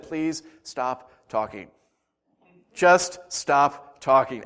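slow, spaced-out plea; drawn-out retold appeal for silence; measured plea. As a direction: beg word by word, very slowly. Retold plea to rambling friend.

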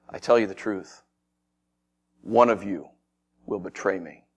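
low, hushed, spaced-out solemn words; grave announcement. Acted. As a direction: break grave news quietly, pausing between phrases. Jesus gravely announces his betrayal.

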